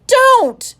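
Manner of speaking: sudden, very high and loud "Don't!"; a boy trying to stop his friend from telling; alarmed cry. Acted. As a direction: blurt it out in panic.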